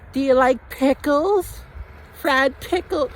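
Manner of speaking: mock high falsetto girl voice, slow; imitating his date. Date quizzing him on pickles, in a mock high falsetto. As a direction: squeak it out like the date. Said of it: "she's like".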